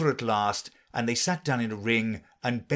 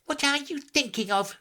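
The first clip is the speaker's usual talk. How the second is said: higher mouse, mildly stern; a touch of severe. A narrator's reading. Mouse scolding Alice, lightly stern. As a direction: press her with mild reproach.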